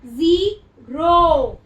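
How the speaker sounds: ज़ीरो very slow and loud, long ई and long ओ; drawn-out answer. Shown as a demonstration. Teacher to class, loudly revealing the word ज़ीरो. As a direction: announce ज़ीरो slowly and loudly. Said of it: "तो क्या बना?".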